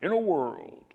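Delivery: high dramatic trailer announcer; movie trailer voice. Shown as a demonstration. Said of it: "sounds almost like a movie trailer".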